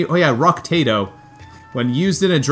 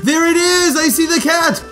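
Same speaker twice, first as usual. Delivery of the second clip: excited shout. Real, not acted. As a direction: yell it out, thrilled at the find. Finding the hidden cat.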